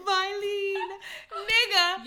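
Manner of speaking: high, loud, laughing wail of Mylene; [name] impression. Friend mimicking the meme of him calling Mylene, high and laughing. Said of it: "Somebody said [name]".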